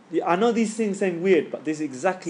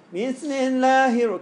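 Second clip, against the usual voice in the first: high, slow, nasal "Bismillah"; nasal imitation; 9 semitones higher, 3 dB louder, much slower than usual. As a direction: mimic a stressed reader reciting through the nose. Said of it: "coming from your no-, nose".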